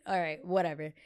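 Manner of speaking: ordinary talk, nothing marked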